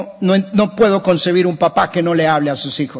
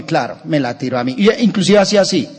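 normal talking voice

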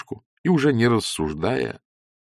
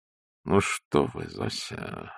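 slow, softer, fading to a whisper; cautious; 12 semitones lower, 8 dB quieter, much slower than usual. A narrator's reading. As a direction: tread carefully, very soft. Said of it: "осторожно сказал командор".

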